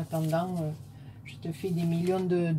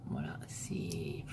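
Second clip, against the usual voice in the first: slow, hushed and much lower, lost for words; speechless whisper; 11 semitones lower, 12 dB quieter, slower than usual. Real, not acted. Vlogger admiring Annie's card, awed whisper. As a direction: let the words fade into a whisper of awe.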